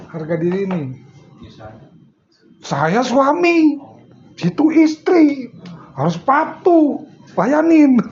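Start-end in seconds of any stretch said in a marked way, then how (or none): 2.6-8.0 higher, slow, lordly mock husband laying down the law; mock bossy husband. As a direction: parody a husband demanding obedience. Mocking a domineering husband. (suami sok berkuasa, nada tinggi dan lambat)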